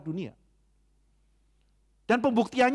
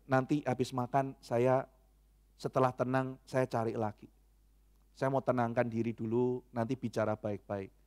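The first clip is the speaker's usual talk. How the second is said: calm, soft promise; 5 semitones lower, 5 dB quieter than usual. Retold or imitated. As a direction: lower and softer, slowed down. Quoted promise to speak calmly later. (janji tenang, pelan dan lembut)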